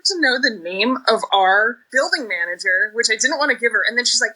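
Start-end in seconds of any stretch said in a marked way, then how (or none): none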